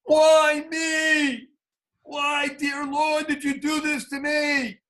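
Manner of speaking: high, pleading cry to God; voicing the bitter question a paralyzed man might ask God; anguished cry. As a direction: cry out why me to the heavens. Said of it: "the great existential question".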